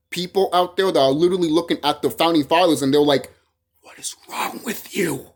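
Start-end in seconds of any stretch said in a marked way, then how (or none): 3.8-5.3 breathy unvoiced whisper, slow and hushed; disgusted whisper. Orc kings whispering at the founding fathers in disgust. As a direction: hiss it like a scandalised onlooker.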